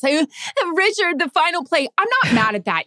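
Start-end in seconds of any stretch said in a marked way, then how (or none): 0.7-1.9 higher, stiff reporter question; mocking her own question. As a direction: parody your clumsy opener. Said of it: "My first question sucked ass".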